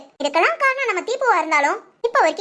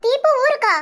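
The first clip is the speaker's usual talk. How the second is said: higher-pitched, startled question; surprised question; 6 semitones higher, slower than usual. Acted. Sister hears Deepu is leaving for home, surprised. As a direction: ask it in sudden surprise.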